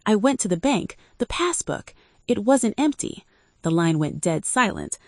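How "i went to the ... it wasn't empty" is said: high, rising panicky voice; naive panic. A narrator's reading. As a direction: sound panicked and naive, voice climbing. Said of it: "perfect frequency of naive panic".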